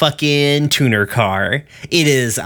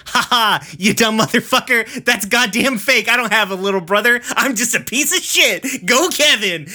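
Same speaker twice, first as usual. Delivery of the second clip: high-pitched cartoonish jerk gloating; hammed-up villain's gotcha; gloating taunt; 10 semitones higher than usual. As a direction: crow mockingly, pitched way up. Acted villain gloats after fake sob story.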